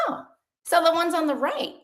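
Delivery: high-pitched dawning interest; curious. Acted. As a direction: play someone getting curious. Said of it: "start to be curious about it".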